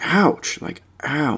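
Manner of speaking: usual speaking voice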